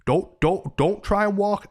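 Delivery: annoyed. Retold or imitated. Warning a stranger off through his door, annoyed. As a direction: retell an irritated warning, firm and loud. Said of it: "I was annoyed about it".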